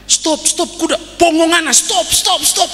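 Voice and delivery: very high, frantic cries to halt a horse; acted panic. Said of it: "panik Bapak Ibu".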